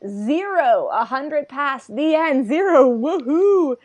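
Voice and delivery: imitated cheer building to a high, loud whoop; mock elation, drawn out into a big woohoo; excited tired guy. Host retells the tired guy getting excited, excited cheer. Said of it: "Tired guy gets excited".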